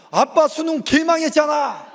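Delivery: mocking son. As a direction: jab at dad like a cheeky teenager. Son mocking father's exam. (아빠를 놀리는 아들 흉내)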